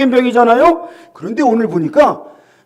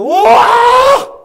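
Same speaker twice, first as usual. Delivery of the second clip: high, long drawn-out wow; healed lepers crying out in amazement, very high wow; amazed wow; 12 semitones higher, 4 dB louder, much slower than usual. Retold or imitated. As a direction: marvel at a miracle. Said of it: "깜짝 놀랐어요".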